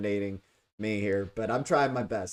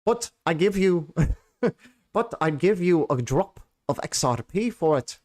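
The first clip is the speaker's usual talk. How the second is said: hammed-up Russian accent breaking into laughter; mock Russian accent; 5 semitones higher than usual. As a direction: haggle like a cartoon Russian, giggling.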